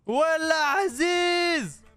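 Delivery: drawn-out call. Real, not acted.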